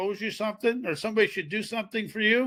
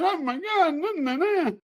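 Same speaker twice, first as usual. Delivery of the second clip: high, wavering panicked babble; mock panic; 10 semitones higher than usual. Speaker mocking own panic fanatic moments, panicked. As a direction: act out a comic panic, pitch swinging way up. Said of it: "panic fanatic and saying".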